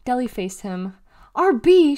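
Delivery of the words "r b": high, loud exasperated holler. A narrator's reading. Deli turns on her tagalong brother, hollering. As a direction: holler his name to stop him. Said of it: "she hollered".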